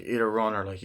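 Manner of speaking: usual speaking voice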